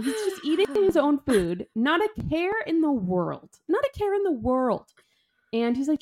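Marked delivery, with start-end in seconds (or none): 0.0-4.9 high-pitched, exasperated disbelief; incredulous indignation. Real, not acted. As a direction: marvel indignantly at his carelessness. Indignant about the careless dad.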